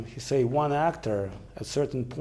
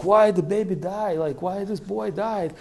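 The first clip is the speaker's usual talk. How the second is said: higher, louder cries of alarm; alarmed villagers; 8 semitones higher, 5 dB louder than usual. Retold or imitated. Shocked villagers cry out at the fallen boy, higher and louder. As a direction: cry out at the boy who has died.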